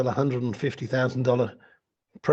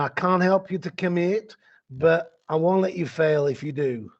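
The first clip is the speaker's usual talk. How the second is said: put-on slow, loud Nashville accent, pitched up; 4 semitones higher, 3 dB louder than usual. Coach quotes a colleague's favourite closing line, in a Nashville accent. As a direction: put on your best Nashville accent, drawn out. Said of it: "in my best Nashville accent".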